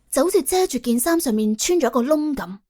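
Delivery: ordinary delivery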